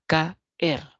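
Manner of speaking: «caer» split into two beats, ca - er, with a clear gap; sílabas separadas. Shown as a demonstration. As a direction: say «caer» syllable by syllable, ca, then er. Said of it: "se pronuncian en distintas sílabas". («caer» separado en dos sílabas: ca - er)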